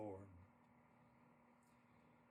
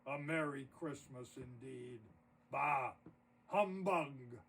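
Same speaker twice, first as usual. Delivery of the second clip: grumbling; 3 semitones lower than usual. A narrator's reading. Scrooge scoffs at a merry Christmas, grumbling. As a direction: grumble it, then burst out on "Bah! Humbug!". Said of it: "Scrooge grumbled".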